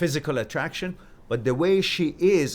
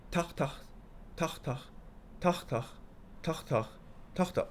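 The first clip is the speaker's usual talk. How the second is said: hoofbeat sounds; 4 semitones higher, 8 dB quieter than usual. Retold or imitated. Reading horse hoofbeat sounds.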